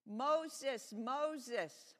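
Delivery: loud, high call from on high. A narrator's reading. God calls to Moses from the bush, loud and high. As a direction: call the name out, booming and insistent. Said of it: "said a loud voice".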